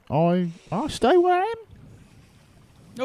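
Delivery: high, slow peppy wolf; chirpy animal sidekick; peppy direwolf voice. Acted.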